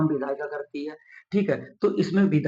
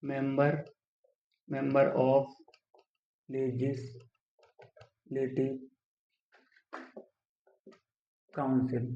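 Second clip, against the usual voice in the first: very slow, softer, broken by long pauses; dictating while writing; 6 dB quieter, much slower than usual. Shown as a demonstration. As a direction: murmur it piece by piece while writing. Writing the term out while saying it. (लिखते हुए धीमे, टुकड़ों में)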